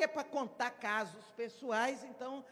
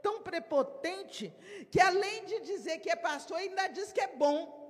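higher, louder mocking gossip, rising to the end; scornful onlooker; 5 semitones higher, 4 dB louder than usual. Onlooker scoffing at Jesus calling himself good, mocking. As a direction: sneer at his boldness. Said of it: "Alguém que visse de longe falar".

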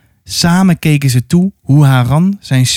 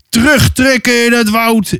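high, carrying call; shouted order; 7 semitones higher than usual. Acted. Haran orders the retreat into the wood, calling out high. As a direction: call the retreat out on a raised pitch, volume held back.

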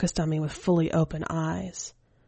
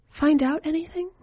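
put-on higher, light boyish voice; child's voice; 11 semitones higher than usual. Keiichi asks the skeleton woman for news, in a higher boyish voice. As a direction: voice the boy, eager and casual.

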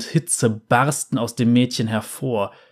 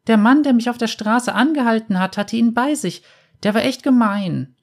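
put-on high child's voice, telling tales; little girl voice; 10 semitones higher, 3 dB louder than usual. A narrator's reading. Girl tells the bounty hunter about a mean man, high child's voice. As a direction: tattle like a small girl, pitched high.

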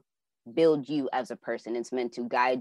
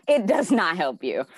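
laughing; 6 semitones higher, 6 dB louder than usual. Real, not acted. Laughing through her advice.

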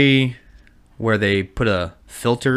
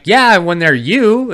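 slow joking retort; 9 semitones higher, 5 dB louder, slower than usual. Retold or imitated. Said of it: "I was like".

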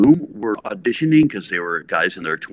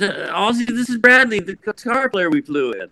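higher, brighter introduction; a hint of Sharon's higher voice; 13 semitones higher, 5 dB louder than usual. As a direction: present him like a proud hostess.

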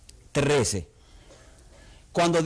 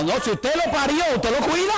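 challenging; 6 semitones higher than usual. Real, not acted. Preacher challenging the congregation.

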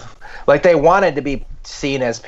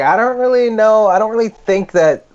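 high-pitched, hesitant dad imitation; doubtful dad; 10 semitones higher than usual. Son imitating his dad's doubts about the war, hesitant. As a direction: mimic a dad reluctantly hedging. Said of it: "my dad was like".

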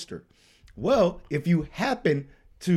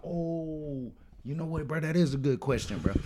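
softer, musing mock reply; feigned pondering; 8 dB quieter than usual. He imagines someone answering Jay's question, feigned pondering. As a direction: drop your voice as if mulling it over.

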